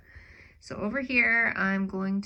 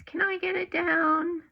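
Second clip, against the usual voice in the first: much higher, eager bear voice; voicing the bear; 10 semitones higher, 4 dB louder than usual. Bear offers to get the bird's nest down, high eager voice. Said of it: "And Bear says".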